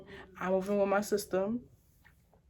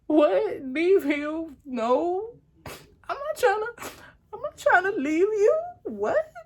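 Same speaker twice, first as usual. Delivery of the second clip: much higher, loud, tearful pleading; panicked plea; 11 semitones higher, 5 dB louder, slower than usual. Retold or imitated. Girlfriend pleading she won't leave the boyfriend with a knife. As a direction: plead frantically, voice up high. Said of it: "I just started crying".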